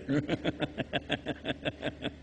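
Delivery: quiet real chuckle; soft laugh. Real, not acted. Preacher to the crowd, laughing softly at himself. Laughs at own stupid jokes.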